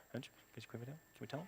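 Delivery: barely audible whisper; hushed conferring. Retold or imitated. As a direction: whisper it furtively, as if consulting colleagues. Studio staff secretly confer, retold.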